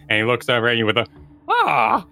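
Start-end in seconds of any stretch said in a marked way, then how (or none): 1.5-2.1 put-on high sudden yelp of an old man caught stealing; startled old man yelp. Old man caught pulling potatoes, high startled yelp. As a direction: squawk in surprise, pitched way up.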